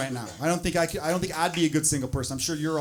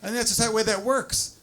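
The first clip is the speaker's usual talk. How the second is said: normal talking voice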